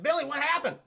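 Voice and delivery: high, urgent mock concern; acted urgent question. Hero urgently questions the boy.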